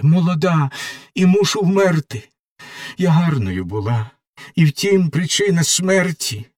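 a lightly voiced young prisoner begging for mercy; pleading doomed girl. Margarita in her cell begs the executioner, desperate pleading. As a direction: plead with the executioner for your life, raw and frightened.

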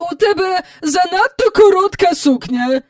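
loud, high-pitched scolding roar. A narrator's reading. Miss Andrew finding fault with Jane's dress, roaring. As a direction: roar the criticism at her. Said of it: "ревнула міс Ендрю".

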